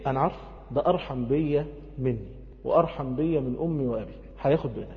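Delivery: hushed, tender certainty; quiet trust. Acted. Moses sure of his Lord's mercy, quiet trust. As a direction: say it softly and low.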